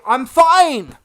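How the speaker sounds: slow, loud strained reassurance; a hammed-up breathless insistence; mock I'm fine. Streamer to chat, mocking himself after the gasp, strained. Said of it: "every five seconds you go".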